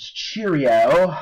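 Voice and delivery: hearty, drawn-out mock-British greeting; high, loud sing-out in a put-on British accent. Teacher greeting the class as John Adams, mock-British accent. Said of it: "If you want to do a British accent".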